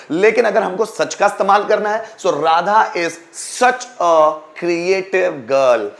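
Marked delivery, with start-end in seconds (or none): 3.6-5.9 very slow, each word spaced; slow dictation. Shown as a demonstration. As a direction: read it out slowly for students to write. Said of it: "teacher dictates example sentence".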